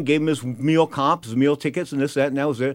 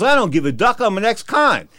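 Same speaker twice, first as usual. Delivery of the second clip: cocky, raised voice of a tough guy; defiant tough guy; 6 semitones higher than usual. Retold or imitated. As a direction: throw it back at him, defiant. Ex-con fighter defies promoter.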